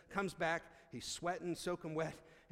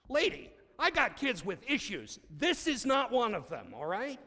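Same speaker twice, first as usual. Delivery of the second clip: raised, higher voice scolding the mother; heated rebuke; 9 semitones higher, 11 dB louder than usual. Retold or imitated. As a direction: stand up for the kid, loud and hot. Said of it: "I'm like".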